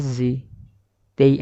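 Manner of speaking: normal talking voice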